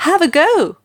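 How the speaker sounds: high, loud call; a bright shouted urging. A narrator's reading. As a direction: shout it out eagerly. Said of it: "she shouted".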